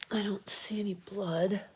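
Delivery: whispered while checking the cat. Real, not acted. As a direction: breathe it without voice.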